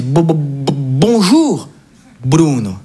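halting, tearful stammer on the first syllable; stammering grandpa. Retold or imitated. Grandson retold, grandfather greets him stammering and moved. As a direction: stumble through the greeting, choked up.

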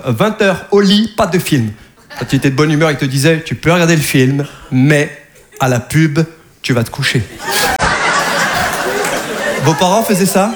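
ordinary talk, nothing marked

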